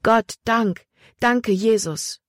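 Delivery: high, slow thanks to Jesus; tearful, slow praise; grateful prayer. A narrator's reading. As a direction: lift the words up like a prayer. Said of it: "hob Emma ihre Arme zum Himmel".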